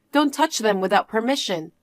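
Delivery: usual speaking voice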